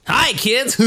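high hammy laughing greeting; goofy kids' host hello; host impression. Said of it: "horrible impression".

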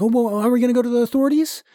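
high, quick, flustered voice; mock anxious question. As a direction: hurry it out high, playing the worried sidekick. Acting out anxious accomplice's question.

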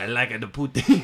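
mock French Canadian accent. Shown as a demonstration. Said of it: "can you do French Canadian?".

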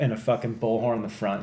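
usual speaking voice

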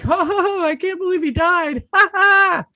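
loud, very high fake laughter through the words; hammed-up high-pitched guffaw; mock laughing. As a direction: act out laughing at terrible news. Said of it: "Laughing, seeming like someone's like".

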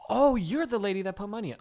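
high, loud feigned amazement; sarcastic mock recognition. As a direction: pretend to be hugely impressed, sarcastically. Mock reply to pushy customer.

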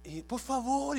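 high, drawn-out imitated begging; retold tearful plea. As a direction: beg like the crying woman, hammed-up. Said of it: "comienza a llorar". (súplica aguda y llorosa imitada)

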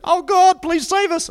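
high-pitched mock plea to heaven; hammed-up desperate praying; panicked prayer. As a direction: cry out like a frightened sailor. Acts sailors praying in panic.

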